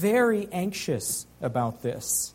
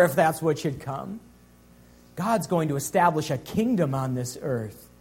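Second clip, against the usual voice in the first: usual speaking voice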